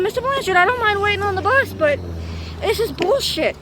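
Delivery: plead, fed up; frustrated plea. Retold or imitated. Student pleading with bus driver Mister Blanchard.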